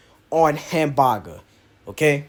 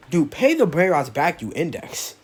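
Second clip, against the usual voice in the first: normal talking voice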